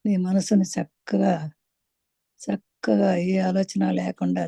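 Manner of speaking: calm, measured meditation leader; guided meditation. Acted. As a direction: guide them gently into stillness, stretching the soothing words. Leads listeners into meditation.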